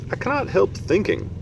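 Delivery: lightly voiced friendly giant; kindly giant asking after a child; BFG giant voice. BFG voice, higher.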